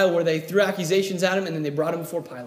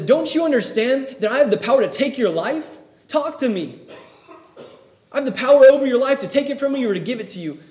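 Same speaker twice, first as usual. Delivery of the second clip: higher and louder demand, speeding up at the end; forceful Pilate; 7 semitones higher, 6 dB louder than usual. Retold or imitated. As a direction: press him hard, demanding an answer. Pilate confronts silent Jesus.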